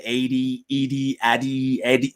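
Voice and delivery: listing wrong versions: "A D", "Edie", "Addy", "Eddie", each with a different vowel; mispronounced name. Retold or imitated. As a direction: reel off "A D, Edie, Addy, Eddie" as botched names. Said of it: "call my name a certain kind of way".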